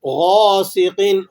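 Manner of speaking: غَاسِقٍ with a full, heavy غ, slowly; heavy ghain. Shown as a demonstration. Teacher shows the heavy ghain to students. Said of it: "غین کو ہم موٹا کیوں کر رہے ہیں".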